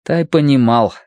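ordinary delivery, nothing marked

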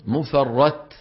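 normal talking voice

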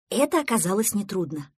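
plain reading voice

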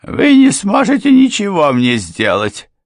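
high, loud, desperate protest; cling to defiance out of panic; desperate retort. A narrator's reading. Housekeeper defying Derrika, desperately high and loud. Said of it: "с отчаянием возразила та".

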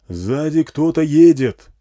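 slow, loud, high shout; shouting over engine. A narrator's reading. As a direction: shout a warning over the rattle of a motor. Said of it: "крикнул он, перекрывая тарахтение мотора".

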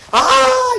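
sharp high scream of shock; retold scream. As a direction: shriek in sudden horror. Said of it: "empezó a gritos". (grito agudo de espanto)

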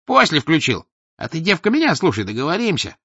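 high, loud scheming hustler; sly crowd voice. Acted. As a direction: push the girl to cash in. Same scheming bystander. (подлый голосишка из толпы)

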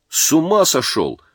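plain reading voice

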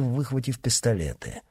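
usual speaking voice

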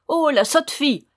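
high, loud, mocking exclamation; jeering dwarf. A narrator's reading. First dwarf mocking the proud girl, jeering.

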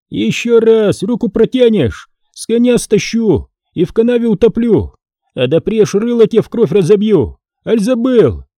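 high-pitched menacing warning; high, forceful quarrelling voice; acted threat. Foma threatens Boriska in a quarrel.

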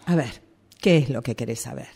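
normal talking voice

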